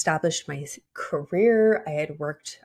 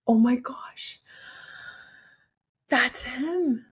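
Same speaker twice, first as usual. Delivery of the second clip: emotional whisper; 9 semitones higher, slower than usual. Retold or imitated. Retelling vision, emotional whisper.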